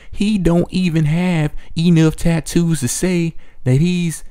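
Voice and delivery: hammed-up Southern accent, pitched high and dragged out; mocking Southern accent. Mocking Duchess's scorn for Puma's business skills, exaggerated Southern accent. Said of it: "in her southern slave accent, she says".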